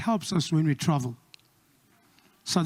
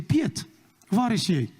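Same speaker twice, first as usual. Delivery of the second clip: mock Afrikaans. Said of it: "we say".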